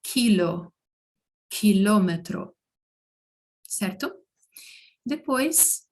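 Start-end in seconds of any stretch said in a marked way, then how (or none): 0.0-2.5 "quilo, quilômetro" set apart as careful Spanish models; Spanish example words. Shown as a demonstration. Teacher giving learners K examples, careful Spanish pronunciation. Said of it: "tem o mesmo som que em português".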